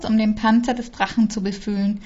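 usual speaking voice

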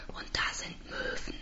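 tonloses Flüstern; geflüstert. Acted. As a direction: hauche es hinterher. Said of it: "füge ich noch hinzu". (geflüstert)

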